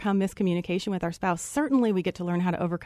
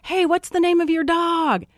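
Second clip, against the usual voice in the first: calling down; 8 semitones higher, slower than usual. Retold or imitated. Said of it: "So I yell down to the mountain".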